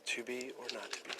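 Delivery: hushed, reflective Hamlet line; soft musing. Shown as a demonstration. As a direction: let it fall away quietly. Quieter second Hamlet line.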